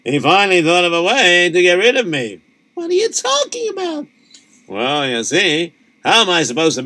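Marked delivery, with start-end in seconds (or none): 2.7-4.1 wife voice. Acted. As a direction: play the worried wife, pitched way up. Wife voice answers husband.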